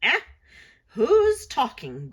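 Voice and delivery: put-on crotchety old crone, loud and slow; grumbling old witch. A narrator's reading. Old witch startled by a voice at her feet, grumbling. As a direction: grumble it out like a cranky old hag. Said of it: "grumbled the old witch".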